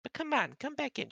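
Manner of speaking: very high gentle cat voice; comforting cat. Acted. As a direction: coax him like a kind kitten. Rita coaxing.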